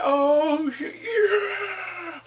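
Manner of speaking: high, loud triumphant shout; gleeful cheer. Real, not acted. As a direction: cheer like your team scored. Viewer cheering Littlefinger's downfall.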